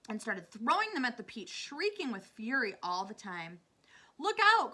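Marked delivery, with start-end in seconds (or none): 4.2-4.7 high, loud warning shout; crying out. A narrator's reading. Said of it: "cried James".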